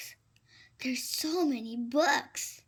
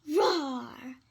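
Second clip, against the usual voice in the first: dragon roar. Pretend. Pretending to fight a dragon.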